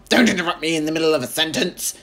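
fast, loud cranky grandma shout. A narrator's reading. Grandma shouting at George for interrupting. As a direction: bark it fast at the boy. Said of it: "she shouted".

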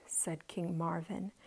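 ordinary delivery, nothing marked

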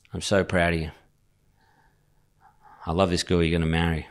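lightly voiced dad making a sincere speech; heartfelt dad. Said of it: "And he said".

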